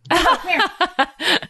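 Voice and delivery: high, drawn-out coaxing call; slow, high-pitched summons to a puppy; calling a dog. Real, not acted. Owner showing Rizzo knows his name, slow high coaxing call.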